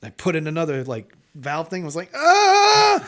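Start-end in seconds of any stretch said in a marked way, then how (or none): 2.1-3.0 loud, high comic scream; sudden full-voiced mock cry of alarm; imitated scream. Retelling the valve fix, panicked mock scream. Retold struggle to stop the water.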